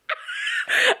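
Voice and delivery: a burst of high airy laughter; high breathy laugh. Real, not acted. Laughing at the book's crude boys, high airy laugh. Reacting to the book.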